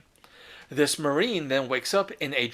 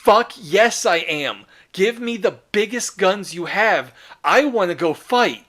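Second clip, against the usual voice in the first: loud, higher, fired-up yes; eager soldier; 4 semitones higher, 6 dB louder than usual. Retold or imitated. The Dreadnought answering his Primarch, loud and eager. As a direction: roar back ready for battle. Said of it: "the Dreadnought goes from having a panic attack to going".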